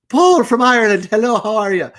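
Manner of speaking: high-pitched, hammed-up Irish accent; loud Irish greeting easing off, badly faked accent. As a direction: put on a cartoonish Lucky Charms Irish brogue. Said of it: "my Irish accent is just like Lucky Charms. It's very bad".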